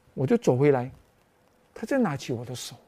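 ordinary talk, nothing marked

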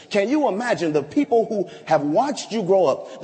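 normal talking voice

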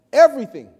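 "Everything" punched out loud and falling; emphatic one word. Real, not acted. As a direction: hit "Everything" hard, then let it drop. Stressing his near-tears moment.

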